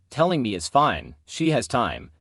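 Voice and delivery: ordinary delivery, nothing marked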